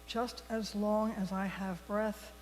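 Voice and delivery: usual speaking voice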